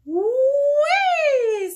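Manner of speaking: long, high, loud whoop; gleeful wind whee. Acted. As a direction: whoop like wind whirling round the trees. Said the wind, whirling around.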